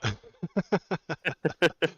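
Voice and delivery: long, high, loud laugh; real high-pitched laughter. Real, not acted. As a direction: cackle at your own joke. Host laughs at own masculinity joke.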